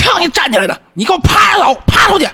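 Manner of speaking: high-pitched furious commands, imitated; master yelling. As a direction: holler orders as an enraged master. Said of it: "我师傅跟那嚷嚷".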